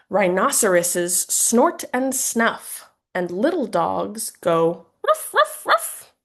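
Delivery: put-on reading to children. Shown as a demonstration. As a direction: bark like a little puppy for a toddler. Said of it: "an example of how you might read this book".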